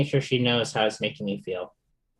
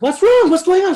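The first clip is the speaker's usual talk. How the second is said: much higher, louder alarmed what's wrong; startled and worried, voice jumping up; alarmed concern; 8 dB louder than usual. Retold or imitated. Reenacting waking to crying mom at 3am, alarmed.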